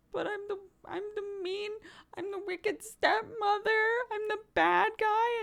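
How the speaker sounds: put-on very high, whiny sobbing kid voice; mock crying child. Girl complaining to mom about her villain role, mock sobbing. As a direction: wail it like a heartbroken little girl. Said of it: "I came home and I cried".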